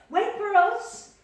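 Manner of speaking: high, loud shout to wait; calling out. Acted. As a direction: call after them from afar. Unicorns calling out, clattering.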